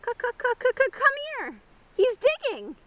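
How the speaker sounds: high, loud clucking hen voice; shrill clucking hen. A narrator's reading. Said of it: "a shrill clucking was heard".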